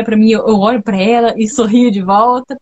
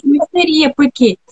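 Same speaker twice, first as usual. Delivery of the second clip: ordinary delivery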